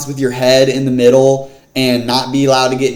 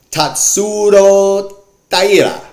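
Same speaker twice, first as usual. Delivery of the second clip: slow, higher, drawn-out Spanish accent; 7 semitones higher, slower than usual. Real, not acted. Host announces the main event fighter, in an accidental Spanish accent. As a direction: stretch the name out with a Spanish accent. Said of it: "said that with a Spanish accent".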